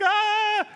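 high-pitched comic "ah!"; mock scream. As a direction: let out a high mock shriek. Shepherds scream at the angel.

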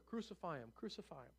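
soft, falling echo of the chant; muted mock shout, dying away; hushed crowd cry. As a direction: say the shout quietly, letting it fade. Crowd's cry, repeated softly afterwards.

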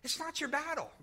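soft, almost to himself; a preacher remembering the moment it struck him; quiet, musing. Real, not acted. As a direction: say it hushed like a sudden realisation. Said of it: "I started listening, hearing that".

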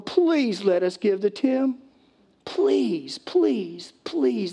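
high, drawn-out pleading; hammed-up, insistent begging. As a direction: plead like you're dying to give. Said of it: "They were begging Paul".

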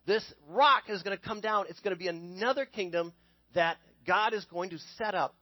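usual speaking voice

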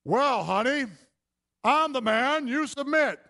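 high, loud pompous husband voice; mock bossy husband. Husband ordering his wife to submit, mock pompous. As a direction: puff up like a domineering husband. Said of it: "What's this man do?".

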